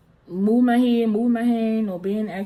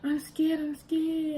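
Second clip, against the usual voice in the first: put-on scared child; 7 semitones higher, 4 dB quieter, slower than usual. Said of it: "I was like".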